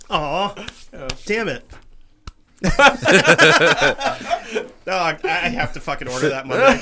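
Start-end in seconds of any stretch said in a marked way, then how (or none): 2.6-4.8 big, high burst of laughter; loud laughter. Real, not acted.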